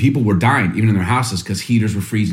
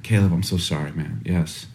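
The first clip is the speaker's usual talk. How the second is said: softer, gentle comfort to the son; soft apology; 5 semitones lower, 6 dB quieter than usual. Retold or imitated. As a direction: comfort the crying son quietly. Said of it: "I'm like".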